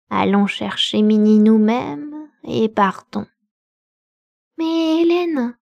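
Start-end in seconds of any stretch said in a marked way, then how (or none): 4.6-5.6 high, stretched "Hélène" in shock; astonished cry. A narrator's reading. A little girl, stunned, cries out to her nurse. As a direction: gasp out her name, stunned. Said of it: "s'écria-t-elle les yeux grands ouverts".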